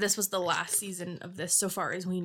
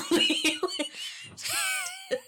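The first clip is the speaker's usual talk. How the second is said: high wheezy laugh; squeaky wheeze of laughter; wheezing laugh. Real, not acted. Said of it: "how you wheeze quietly".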